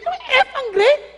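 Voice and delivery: high, loud disbelieving question; shocked parent. Acted. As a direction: react aghast to the failing grade. Acting parent shocked at failing grades. (gulat na magulang)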